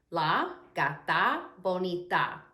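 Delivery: "La gata bonita" slowly, word by word; slow, carefully spaced Spanish example; slow teaching repeat. Shown as a demonstration. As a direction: separate La, gata and bonita for learners.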